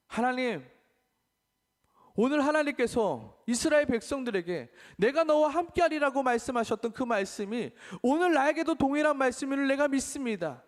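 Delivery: high, loud impassioned prayer; fervent prayer. Acted. Pastor leading the congregation in prayer, loud and high. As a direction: pray with full fervour. Closing prayer at full force.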